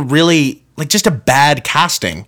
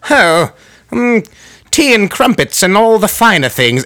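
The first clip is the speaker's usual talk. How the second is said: higher, posh-sounding mock British accent; fancy British accent; 7 semitones higher than usual. Vlogger mocking how people sound fancy, mock British accent. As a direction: play a fancy Brit sipping tea. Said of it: "they go British".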